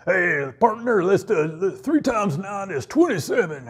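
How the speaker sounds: a light cowboy voice, not far from his own; hint of an Old West cowhand, easygoing; mock cowboy drawl. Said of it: "talking with a cowboy voice".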